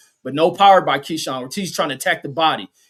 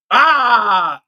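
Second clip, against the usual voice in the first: pained shout; 7 semitones higher, 9 dB louder than usual. Real, not acted. Sees the body shot land.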